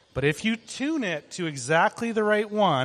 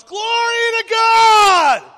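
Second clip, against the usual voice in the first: full-throated high shout of praise; 11 dB louder, slower than usual. Shown as a demonstration. Preacher demonstrating creation's loud cry to the kids, shouting. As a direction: yell it at the top of your lungs. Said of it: "yelling out at the top of its lungs".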